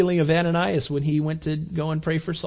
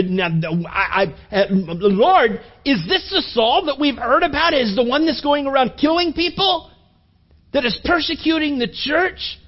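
higher, hesitant, disbelieving; incredulous stammer; 7 semitones higher than usual. Retold or imitated. Ananias questioning the Lord about Saul, higher incredulous stammer. Ananias doubting the Lord's command.

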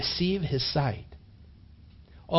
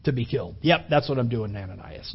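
mock sarcasm; 6 semitones lower, 4 dB quieter than usual. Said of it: "No, that's not what he was doing".

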